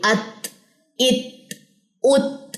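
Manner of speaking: अत, इत, उत each ending in a released breath; prominent hams. Shown as a demonstration. Teacher showing ta with jazm, breathy release ending अत, इत, उत. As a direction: let air escape after the 't' of अत, इत, उत. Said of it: "ज्यादा prominent होती है".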